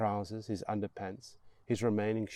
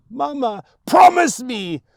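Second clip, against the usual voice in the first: loud, high plea; desperate shout; 18 dB louder than usual. Acted. Boy begging his mother to promise too, shouted. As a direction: yell it back at her.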